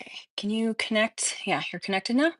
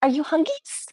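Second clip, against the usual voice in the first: high cooing pet voice; 8 semitones higher than usual. Real, not acted. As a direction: coo at the hungry puppy. Baby talk asking dog.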